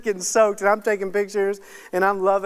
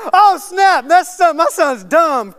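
loud, high-pitched comic outburst; retold shock, pitched way up and loud; hammed-up dad's disbelief; mock alarm; 9 semitones higher, 10 dB louder, slower than usual. Acts out his own shocked reaction.

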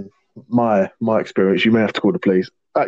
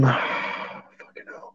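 whisper; 9 dB quieter, much slower than usual. Real, not acted. Stunned by the shocking question.

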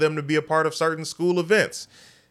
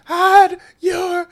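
mock sob, pitched much higher and louder; mock crying; 6 dB louder than usual. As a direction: wail it like a fake cry, then drop it. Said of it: "no, I'm joking".

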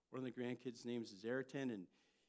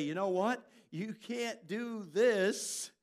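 slow, high, loud stern teacher voice; 6 semitones higher, 10 dB louder, much slower than usual. Retold or imitated. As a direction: lay down the law like a teacher. Teacher correcting students.

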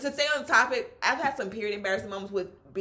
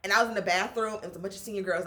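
normal talking voice

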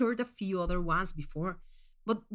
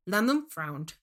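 ordinary delivery

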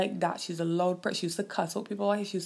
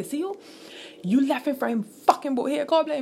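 man cussing her; 7 semitones higher, 5 dB louder than usual. Retold or imitated. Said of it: "A man cuss she and tell she".

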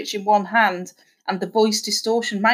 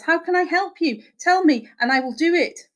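higher-pitched earnest plea; pleading; 8 semitones higher than usual. A narrator's reading. Husband offers to do anything for his wife, pleading. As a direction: beg to be allowed to help. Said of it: "pleaded Horace".

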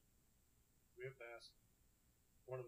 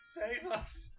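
mock high plea; 10 semitones higher, 8 dB louder than usual. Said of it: "they're like".

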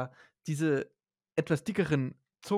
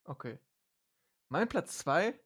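usual speaking voice

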